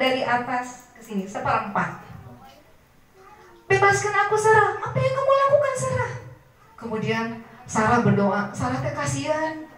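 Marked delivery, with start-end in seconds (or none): 3.7-6.1 high, frantic pleading in pain; desperate begging king. Acted. Stricken king begging Sarah for release, high frantic plea. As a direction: beg her to set you free.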